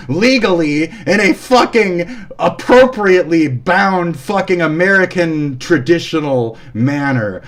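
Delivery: high, loud, hammed-up tirade; theatrical raging with swear words punched; mock furious rant. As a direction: relish each threat as it builds. Over-the-top mock threat rant.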